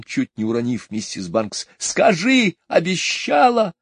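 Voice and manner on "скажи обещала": high, loud desperate cry; a child insisting; high pleading shout; boy shouting. A narrator's reading. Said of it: "закричал он".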